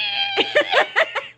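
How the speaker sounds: high-pitched screech of worn brakes; squeaky brake imitation. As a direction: squeal like brakes that need changing. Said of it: "if you hear with brakes that have not been changed sound like".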